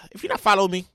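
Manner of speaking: normal talking voice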